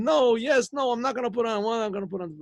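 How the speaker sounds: high-pitched mock argument; retold squabble, pitch jumping up; bickering back-and-forth. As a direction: act out both sides squabbling.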